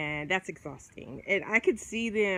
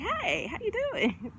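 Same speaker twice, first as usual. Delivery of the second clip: higher, breezy mock reply from the Leo; 10 semitones higher than usual. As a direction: play the Leo answering as if all is fine, pitched up. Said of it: "And they're like".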